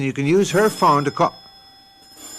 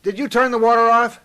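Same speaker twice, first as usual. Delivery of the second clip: raised voice calling to another room; 11 semitones higher, 4 dB louder than usual. Acted. Husband calling to his wife in another room, raised and higher. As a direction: call up the stairs to her.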